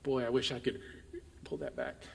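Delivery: low, soft, rueful aside; hushed second thoughts, with a pause; quiet regret. Acted. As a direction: drop right down, as if thinking it to yourself. Voicing regret after angry words.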